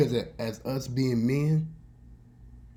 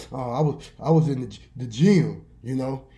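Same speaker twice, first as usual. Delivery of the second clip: hesitant, loud mock excuse; fibbing; 8 dB louder than usual. Boy telling classmates he was in the gym, acted fibbing. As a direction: stumble into a transparent fib. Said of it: "they knew that I was fibbing".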